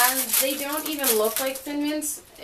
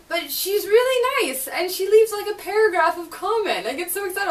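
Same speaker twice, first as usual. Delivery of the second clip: high, loud, gushing delight; excited; 9 semitones higher, 7 dB louder than usual. Real, not acted. Thanking a lovely commenter, bubbling with high excitement. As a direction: gush about her like a thrilled fan. Thrilled about a kind commenter.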